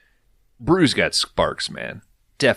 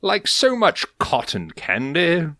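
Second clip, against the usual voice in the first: put-on English accent; 10 semitones higher than usual. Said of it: "The funny part of that is".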